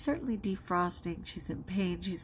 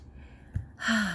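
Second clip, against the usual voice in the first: heavy sigh; 4 semitones higher than usual. Real, not acted. After fearful whisper.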